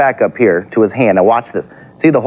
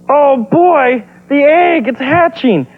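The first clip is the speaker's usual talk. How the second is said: much higher, squeaky cartoon voice; excited little dinosaur; 13 semitones higher, much slower than usual. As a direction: squeal it like an excited baby dino. Eek's excited reaction to egg hatching.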